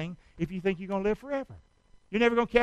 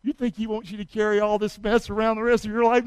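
ordinary delivery

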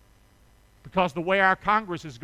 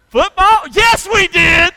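loud, high playful holler; excited shout; 11 semitones higher, 13 dB louder, slower than usual. Acted. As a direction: shout the word back to the crowd. Preacher echoes the crowd's word.

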